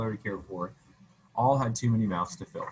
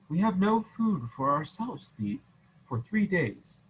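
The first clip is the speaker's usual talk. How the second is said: lightly voiced refusal, higher and slow; a hint of a sorry villager; sad peasant; 8 semitones higher, slower than usual. Paul refusing soldiers.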